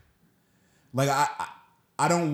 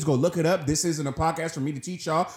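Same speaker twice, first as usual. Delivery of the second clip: ordinary talk, nothing marked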